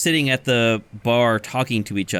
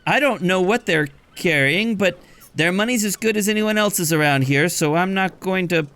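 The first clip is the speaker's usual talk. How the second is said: much higher voice giving directions; chatty barkeep; 7 semitones higher than usual. Acted. Barkeep gives long directions to Cat Salvage, high voice. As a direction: rattle off the route.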